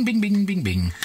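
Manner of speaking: usual speaking voice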